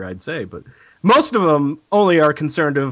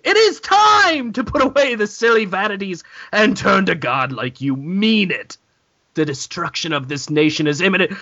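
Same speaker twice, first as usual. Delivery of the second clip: mock fire-and-brimstone reading; 3 semitones higher than usual. Mock reading of church text.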